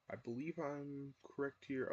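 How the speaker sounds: normal talking voice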